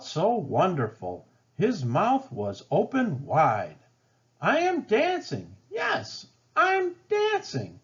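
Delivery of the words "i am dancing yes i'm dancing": high, thrilled exclaiming; much higher, giddy with joy; joyful cry. A narrator's reading. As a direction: burst out with delight at finally dancing. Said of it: "Gerald cried".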